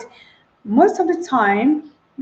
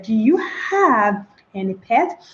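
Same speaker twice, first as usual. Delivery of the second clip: "Do you have any pet." slow and mechanical, each word apart; a stiff, word-by-word question; slow, separated words; slower than usual. Shown as a demonstration. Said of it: "That is rather unacceptable".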